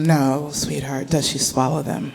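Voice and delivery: soft, slow mom voice; quiet stalling. Retold or imitated. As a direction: hedge gently with a child.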